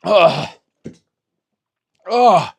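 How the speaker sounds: loud, strained groan from the burn; real groan of pain from scorching sauce. Real, not acted. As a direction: groan as the heat hits. Reacting to six million Scoville.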